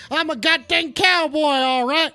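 upset cowboy. Acted. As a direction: protest like an insulted cowboy. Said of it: "Now I'm upset".

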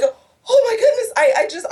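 breaking into real tears, voice jumping high; real crying. Real, not acted. Overwhelmed by the kids' standing ovation, crying. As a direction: let the words crack as you cry. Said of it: "I'm crying".

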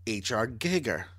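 Giger said with the accent; deliberately accented Giger. Shown as a demonstration. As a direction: say Giger with a hard G, putting on the accent. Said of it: "say it with the accent".

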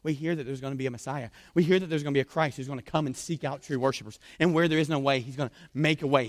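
ordinary delivery

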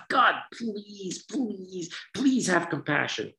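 high, strained begging to God; pleading prayer. Retold or imitated. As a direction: beg like a pained worshipper. Mocking pained pleading prayer.

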